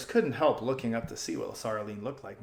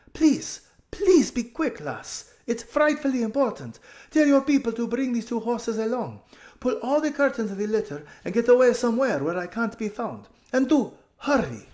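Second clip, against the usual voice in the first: higher, pressing and hurried; urgent girl; 11 semitones higher, 6 dB louder than usual. A narrator's reading. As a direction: beg her to act fast. Aravis voiced urgently.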